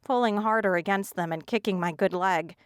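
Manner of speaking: ordinary delivery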